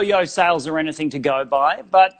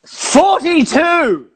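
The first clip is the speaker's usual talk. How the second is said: proud slow shout; 9 semitones higher, 5 dB louder, much slower than usual. Acted. Puppet boasts number of shows.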